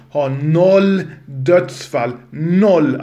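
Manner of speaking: "noll" dragged out slowly, loud and heavy; stress on noll. Real, not acted. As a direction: hammer home "noll", slow and loud. Stressing zero deaths emphatically. (betona "noll" långsamt och högt)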